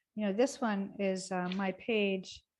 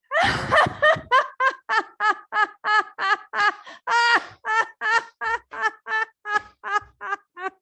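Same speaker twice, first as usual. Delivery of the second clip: loud, high, sustained belly laughing; 12 semitones higher, 7 dB louder than usual. Shown as a demonstration. Teacher leading a laughter yoga belly laugh, full out. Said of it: "the belly laugh goes like this".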